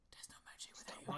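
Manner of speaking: quick voiceless whisper; creepy whisper. Retold or imitated. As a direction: whisper it eerily before the drop. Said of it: "all you hear is".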